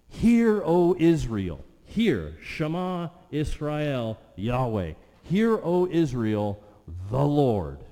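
booming recital of the Shema; proclaiming scripture. Acted. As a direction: call it out like a herald.

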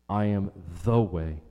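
"the" set apart and stressed as the only one. Shown as a demonstration. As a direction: lean on "the" in "the way", emphatic.

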